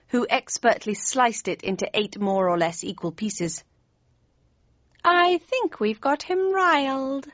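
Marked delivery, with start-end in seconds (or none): none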